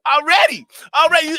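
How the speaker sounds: hammed-up mock eagerness; mock eager. Joking eager bedroom response.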